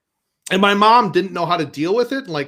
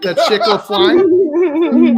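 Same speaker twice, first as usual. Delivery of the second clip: higher, laughing mock fumble; mock whoops; 7 semitones higher, 4 dB louder, slower than usual. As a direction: fake an accident, hammed-up. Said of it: "start mocking her, mocking him".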